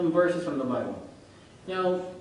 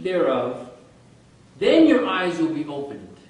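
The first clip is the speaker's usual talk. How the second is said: slow, deliberate recitation of the serpent's words; slow, measured scripture reading; solemn scripture reading; 3 semitones higher, 4 dB louder, slower than usual. Acted. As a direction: read it out like holy writ. Reads serpent's words from Genesis.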